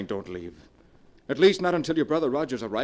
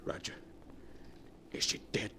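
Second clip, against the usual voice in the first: whispered, shaken question; husband whispering to her brother after the shot; stunned whisper; 12 dB quieter than usual. Acted. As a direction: barely breathe the words. Killer asks in shock.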